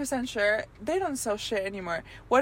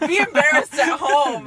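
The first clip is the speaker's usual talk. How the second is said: sassy; 4 semitones higher, 5 dB louder than usual. Pretend. Continuing mock telling off.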